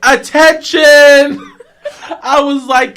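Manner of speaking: loud high scream for attention. Retold or imitated. Kid screaming from the playground structure. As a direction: yell over the whole playground. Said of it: "and I screamed".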